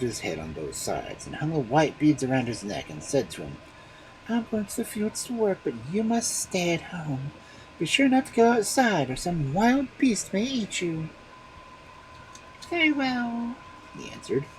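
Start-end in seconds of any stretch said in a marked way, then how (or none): 4.3-11.1 warning mother. A narrator's reading. Mother warning her baby, high. 12.7-13.6 put-on very high baby voice; a tiny child agreeing. A narrator's reading. Baby answering his mother, very high.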